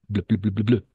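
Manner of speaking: "bleu" repeated as a bare bl ending; drilled bl sound. Shown as a demonstration. Teacher isolating the ending for a student, "bleu" drilled as a short bl. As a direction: rattle off "bleu" as the final sound of table. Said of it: "En la L va a terminar".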